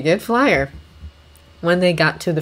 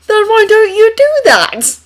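higher, louder exasperated demand; 9 dB louder than usual. Acted. Obi-Wan exasperated at Anakin.

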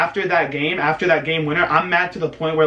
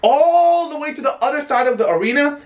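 sarcastic exaggeration; 10 semitones higher than usual.

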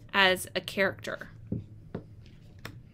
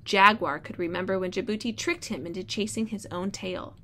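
storybook read-aloud voice; reading to children. Acted. Teacher reads story aloud.

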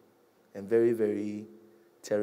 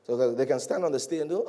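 normal talking voice

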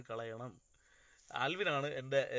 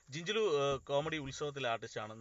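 raised voice, high and loud at the start; speaking louder; 3 semitones higher, 9 dB louder than usual. Real, not acted. Live host introducing a mimicry artist, speaking louder. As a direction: project so listeners can hear. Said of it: "കുറച്ചും കൂടി ഉറക്കെ പറയാം".

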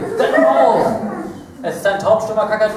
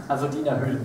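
low aside; 8 semitones lower, 7 dB quieter, faster than usual. Real, not acted. Quick aside after joke.

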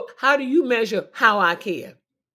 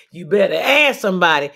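normal talking voice

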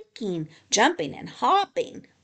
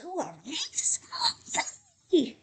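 hushed, airy crowd cheer; 7 semitones higher than usual. A narrator's reading. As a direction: cheer excitedly in a half-whisper. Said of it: "Everyone cheered".

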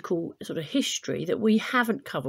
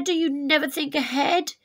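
higher and louder, a furious reproach; angry shout; 7 semitones higher, 7 dB louder than usual. Acted. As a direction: scold him for not thinking ahead. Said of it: "he cried".

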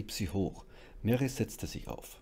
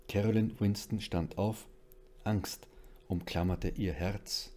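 usual speaking voice

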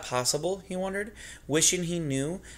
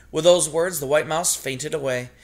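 ordinary delivery, nothing marked

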